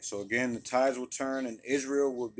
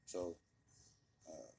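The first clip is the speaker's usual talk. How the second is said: normal talking voice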